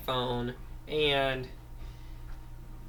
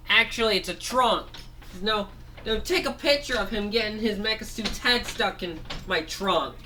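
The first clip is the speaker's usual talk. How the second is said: a somewhat higher toy voice, pleased with himself; gloating elephant figure; 7 semitones higher than usual. Acted. Vacuum owner tells friends to film the captive, lightly high gloating voice. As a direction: brag about the trunk trick.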